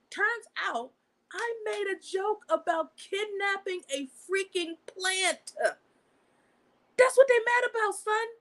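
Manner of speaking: high-pitched, drawn-out disbelief; can't believe something this silly caused it; incredulous. Real, not acted. Vlogger revealing why Facebook banned her, incredulous.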